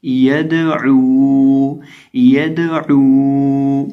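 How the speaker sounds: यदऊ drawn out, the दाल bounced with a little echo; shaken qalqala on दाल. Shown as a demonstration. Quran teacher showing students the qalqala bounce on यदऊ. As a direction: vibrate the दाल in यदऊ, slow and ringing. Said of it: "इसकी आवाज़ यूं आएगी".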